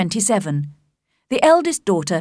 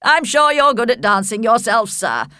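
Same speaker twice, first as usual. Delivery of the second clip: hearty host, raised and louder; cheerful Sir William; 3 semitones higher, 4 dB louder than usual. A narrator's reading. Sir William complimenting Darcy on dancing, cheerfully. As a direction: flatter the guest jovially. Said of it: "replied Sir William cheerfully".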